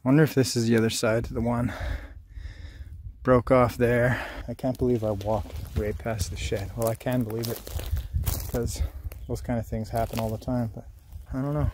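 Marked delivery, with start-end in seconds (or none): none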